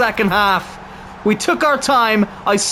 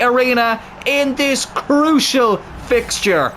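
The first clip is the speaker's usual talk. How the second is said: ecstatic shout; 7 semitones higher than usual. Real, not acted. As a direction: yell it out with joy. His team scores a goal.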